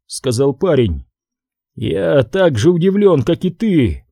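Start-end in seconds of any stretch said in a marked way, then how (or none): none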